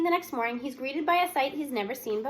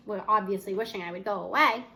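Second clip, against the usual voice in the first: ordinary delivery, nothing marked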